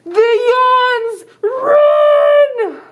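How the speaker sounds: high, loud mock-panicked yell; alarmed shout. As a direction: shout a warning to run, hammed up. Kids flee the yawns.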